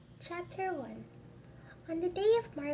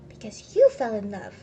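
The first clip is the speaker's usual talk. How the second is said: loud, incredulous scoff; scornful mockery; 2 semitones lower, 8 dB louder than usual. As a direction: echo it back with contempt. Scrooge scorns nephew's marriage.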